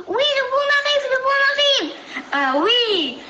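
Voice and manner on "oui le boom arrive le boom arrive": high excited cry; exclaiming. Acted. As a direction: call out the alarm, high. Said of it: "en s'exclamant".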